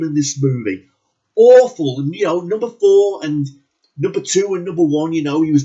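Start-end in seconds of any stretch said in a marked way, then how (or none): 1.4-2.0 "Awful" blurted much louder and higher; loud emphatic verdict. Real, not acted. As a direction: spit out "Awful" like a final judgement. Repeating his verdict louder.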